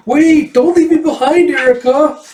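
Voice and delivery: put-on very high, loud little-kid wail; hammed-up high plea of a child left behind; pleading child's call. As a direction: cry out high and loud after someone leaving you. Voices child left behind, calling.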